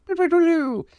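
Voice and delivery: mock tune of a brain gone blank; blank-brain hum. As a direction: hum like an empty, stalled mind. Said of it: "my brain's just like".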